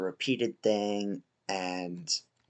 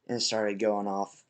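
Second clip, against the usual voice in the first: ordinary delivery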